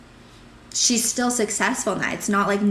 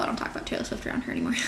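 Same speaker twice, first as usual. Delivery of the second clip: breaking into a laugh through the line; 3 semitones higher, much faster than usual. Real, not acted. Laughing to a friend about avoiding the topic. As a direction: giggle the confession away. Laughing at her own solution.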